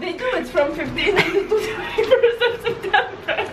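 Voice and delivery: high, amused reply; laughing. Real, not acted. Defending the printed cinema schedule, laughing. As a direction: answer through bubbling laughter.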